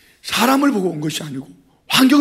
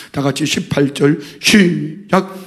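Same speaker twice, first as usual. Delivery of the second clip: usual speaking voice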